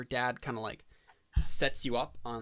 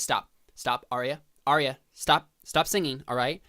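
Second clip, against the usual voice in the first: firm command; 3 semitones higher, 12 dB louder than usual. Acted. Dad orders her to stop.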